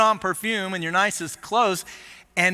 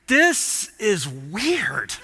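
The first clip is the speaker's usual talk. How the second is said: slow awkward thought; 3 semitones lower, much slower than usual. Retold or imitated. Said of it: "I'm like".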